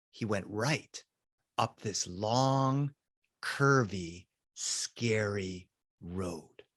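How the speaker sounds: soft, slow ominous narration; spooky hush. A narrator's reading. Narrator setting up scary road, hushed and slow. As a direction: draw it out low and hushed to scare.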